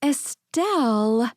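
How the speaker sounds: slow, drawn-out scolding of a name; chiding. A narrator's reading. Friend scolding Estelle, slow chiding tone. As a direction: Lena chiding her friend by name, slowly and pointedly. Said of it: "Lena chided".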